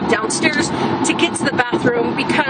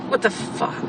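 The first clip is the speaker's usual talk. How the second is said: exasperated whisper; 4 semitones lower, 5 dB quieter than usual. Retold or imitated. Exasperated at the phone call.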